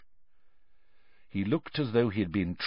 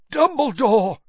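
higher, louder strained gasp; desperate gasped cry; 13 semitones higher, 7 dB louder than usual. A narrator's reading. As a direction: force the name out in a gasp. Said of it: "gasped Mister Crouch".

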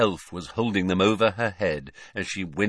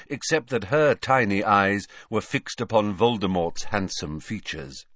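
usual speaking voice

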